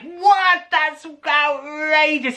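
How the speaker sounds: high, slow, drawn-out disbelief; mock outrage. Narrator feigning outrage at the tall tale. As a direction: stretch it in comic shock. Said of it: "I was like".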